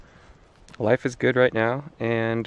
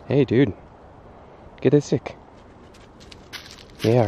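usual speaking voice

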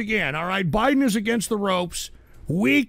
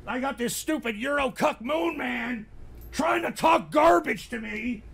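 angry yell; 5 semitones higher than usual. Real, not acted. Streamer to his audience about a troll, angry yell.

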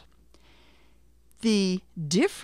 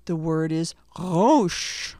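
Rosh said slowly, its r rolled in the throat; slow clear word; 4 dB louder, slower than usual. Shown as a demonstration. Teacher slowly introducing Rosh to learners. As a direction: lay out Rosh slowly for the class. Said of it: "that guttural sound at the beginning".

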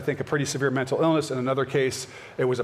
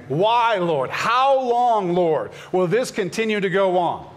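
crying out to God, higher and louder; pleading psalmist; 6 semitones higher, 3 dB louder, slower than usual. Acted. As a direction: lift the lament up to heaven, urgent. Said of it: "you see the saints saying".

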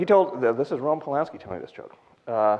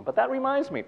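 the same line, still high but softer; repeated quieter; 11 semitones higher than usual. Shown as a demonstration. Comedian repeating her tombstone line, high and softer. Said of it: "I think that should be on my tombstone".

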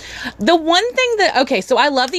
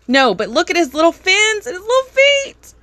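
overwhelmed by cuteness; 6 semitones higher than usual. Real, not acted. Gushing over penguin toy.

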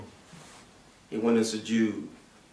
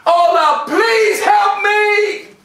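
high, loud cry with a long slow drawn-out help; a sinner crying out to Allah for help, desperate; desperate plea; 10 semitones higher, 4 dB louder, much slower than usual. Acted. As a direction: beg God with everything. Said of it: "You gotta get that repentance".

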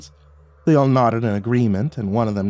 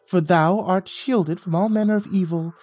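pompous high-pitched royal decree; sprite king calling out; 9 semitones higher than usual. A narrator's reading. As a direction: announce it grandly like a tiny town crier. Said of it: "cleared his throat and called out".

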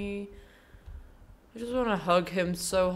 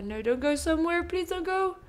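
fast and higher, pleading; urgent plea; 9 semitones higher, faster than usual. Real, not acted. Player begging the bear cub to stay, hurried plea. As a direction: rush the words to stop him leaving.

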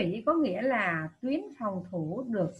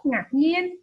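higher and louder, startled tone; surprised reading; 5 semitones higher, 5 dB louder than usual. Shown as a demonstration. Teacher showing pupils how to read a question, surprised. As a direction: sound startled on ngạc nhiên. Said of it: "đọc giọng hoảng hốt".

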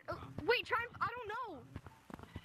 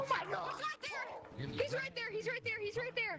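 panicking gamer; 10 semitones higher, 4 dB quieter than usual. Real, not acted. Frantic repeated shouts in game.

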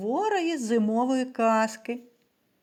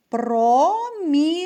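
промінець slow and louder, split про - мі - нець; syllable by syllable; 4 semitones higher, 5 dB louder, slower than usual. Shown as a demonstration. Teacher repeating a word for syllable counting, slow syllabled промінець. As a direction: sound out промінець in three long, raised syllables. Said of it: "Ще раз слухайте".

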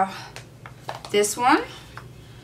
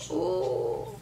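ordinary delivery

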